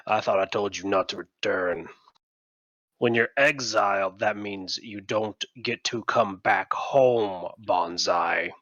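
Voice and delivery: low, measured rebuke; slow down into a deep, stern reproach; stern man on shore. Acted. Man on the shore rebukes exiled Banzai, low and stern.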